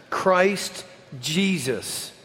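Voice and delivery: each word slow and firmly set; clear, emphatic. A narrator's reading. Darwin answering Lady Hope in a clear, emphatic voice. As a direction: land the name slowly with weight. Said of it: "in a clear, emphatic voice".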